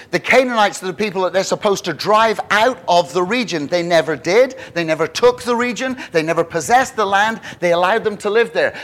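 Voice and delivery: ordinary delivery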